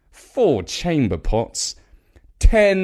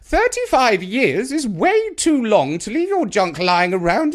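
put-on high, loud, domineering old woman; bossy Grandma; 10 semitones higher, 4 dB louder than usual. A narrator's reading. Grandma ordering Nigel to clear the room, high and bossy. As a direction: lay down the law.

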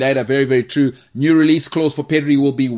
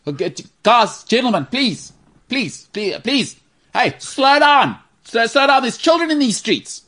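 put-on grumpy old man; 8 semitones higher than usual. Teasing James as a future old man scolding kids. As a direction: yell at the kids like a fussy old neighbour. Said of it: "you're gonna be complaining about".

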